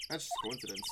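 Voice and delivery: ordinary talk, nothing marked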